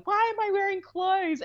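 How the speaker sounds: high-pitched mock yell, no real volume; retold yell. Telling the midwife story of labour, a high mock yell. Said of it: "I just yelled".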